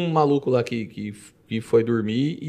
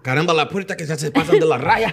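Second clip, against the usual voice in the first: mock Spanish accent; 3 semitones higher than usual. Mock Spanish teacher's line.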